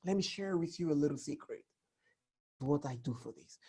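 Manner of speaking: soft, fast confiding murmur; hushed secret-telling; secretive hush. Shown as a demonstration. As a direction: lean in and share it quietly. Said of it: "So the voice is important".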